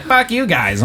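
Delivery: put-on drunk girl snapping. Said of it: "And she was like".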